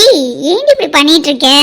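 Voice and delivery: ordinary delivery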